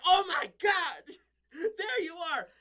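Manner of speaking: high, loud, tearful cry of relief; imitating her sobbing with joy at the door; near tears. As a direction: choke out a teary, laughing reunion. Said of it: "she's almost in tears".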